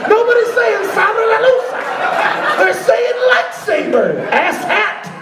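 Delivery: very high-pitched yelling rant; high, exasperated hollering; outraged rant. Acted. Acted outraged rant at pop.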